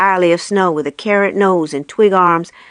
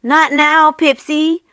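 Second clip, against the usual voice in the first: higher, called out on the run; shout; 7 semitones higher, slower than usual. A narrator's reading. Buddy running past the raccoon, calling out a higher shout. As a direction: toss it back over your shoulder while sprinting. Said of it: "Buddy shouted".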